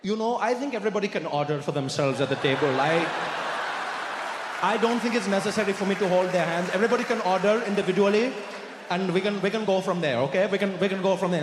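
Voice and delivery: stalling in a fake Indian accent; hammed-up Indian accent; put-on Indian accent. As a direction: keep up the Indian accent, making excuses. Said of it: "I also couldn't stop".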